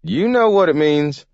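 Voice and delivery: put-on high, loud old-timer's taunt; gleeful old Gramp. Gramp tells the mayor he is out, gleeful. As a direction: crow it as a spry old man.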